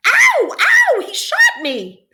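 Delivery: high, loud pained yelps; screaming boy. A narrator's reading. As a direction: shriek as if just shot. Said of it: "Patrick screamed".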